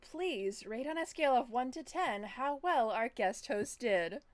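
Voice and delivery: lightly robotic game show host; robot announcer voice. Pretend. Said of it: "you like my voice?".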